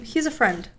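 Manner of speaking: brisk, matter-of-fact, believable; casually sure of it. Shown as a demonstration. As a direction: say it straight, with no hesitation. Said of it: "be more confident".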